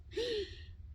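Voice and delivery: sharp, high gasp of fright; startled gasp. Retold or imitated. Testifier reliving her fright at the prophecy, startled gasp. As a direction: re-enact a shocked intake of breath. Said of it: "me asusté tanto".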